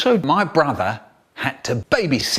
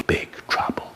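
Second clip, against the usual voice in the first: hushed whisper of big trouble; 7 dB quieter than usual. Acted. Narrator dropping to a secret about trouble, whisper.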